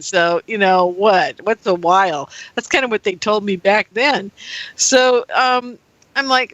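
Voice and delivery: normal talking voice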